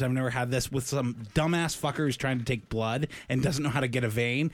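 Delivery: ordinary talk, nothing marked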